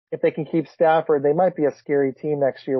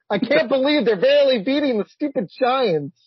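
exasperated laughing rant; 9 semitones higher than usual. Real, not acted. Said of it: "I'm so mad".

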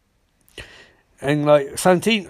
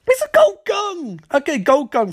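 very high mock-exasperated squeal; exasperated outburst; 11 semitones higher than usual. Cisco arguing about a gold gun, exasperated. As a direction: launch high in disbelief, then come down.

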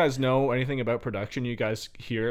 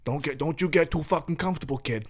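tough coach voice; 3 semitones higher than usual. Pretend. Mock tough coach pep talk.

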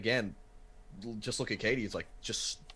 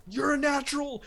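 much higher, louder pumped-up friend; hyped-up friend; 4 dB louder than usual. Retold or imitated. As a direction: egg him on like an excited buddy. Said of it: "him sitting there going, like".

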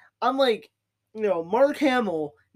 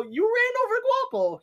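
retold outcry. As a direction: retell it as a much higher exclamation. Retold outburst at Matt over Guapo.

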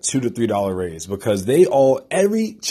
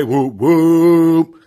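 hype cheer; 6 semitones higher, 8 dB louder than usual. Real, not acted. Cheering for the Atlanta show.

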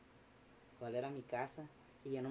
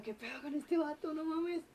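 very high, loud incredulous reaction; acted disbelief; 5 dB louder than usual. Storyteller reacting to the man shouting outside, incredulous. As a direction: replay your stunned panic, pitched way up.

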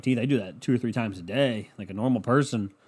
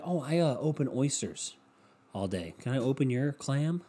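mock sheepish pickup; 6 dB quieter than usual. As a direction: play him answering quietly, dropping away. Acts out oyster opener's awkward answer.

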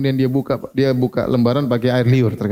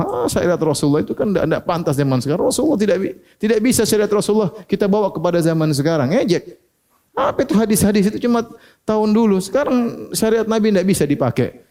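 scornful voice, pitched higher; dismissive mocker; 6 semitones higher than usual. Preacher voices a scoffer of the Prophet's law, pitched high and dismissive. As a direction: wave the law away as outdated.

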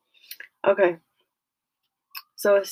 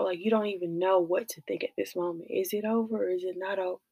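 usual speaking voice

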